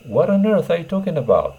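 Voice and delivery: EARTH flung out high and loud in disbelief; exasperated. Shown as a demonstration. Teacher acts out an exasperated native speaker, voice up high. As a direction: sound truly fed up, stressing earth. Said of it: "on earthを挿入してください".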